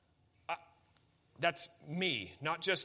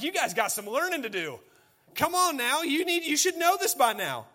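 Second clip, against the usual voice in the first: mock scolding; 8 semitones higher, 5 dB louder than usual. Jesus scolding the Pharisees.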